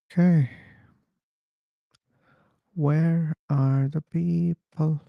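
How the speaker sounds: ordinary delivery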